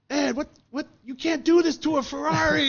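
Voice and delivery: high, loud, aghast mechanic voice; shocked Ferrari mechanic. Retold or imitated. Ferrari mechanics scolding Ed about his headers, mock outraged and high. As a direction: squeal it like an outraged Italian mechanic.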